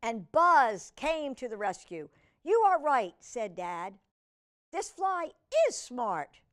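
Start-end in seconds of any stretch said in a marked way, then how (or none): 2.4-3.1 loud, higher agreeing dad; dad won over by the fly; surprised dad. Acted. Dad voiced. 4.7-6.4 loud, higher agreeing dad; dad won over by the fly; surprised dad. Acted. Dad voiced.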